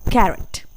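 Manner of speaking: कैरेट said as "ka-ret"; pronunciation demo. Shown as a demonstration. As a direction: model कैरेट clearly. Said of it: "इसे बोला जाता है".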